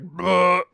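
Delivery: loud high mock gag after a shot; mock retch. Imitating actor after a shot, loud gagging bleh. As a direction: spit out a disgusted bleh. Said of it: "and he's like".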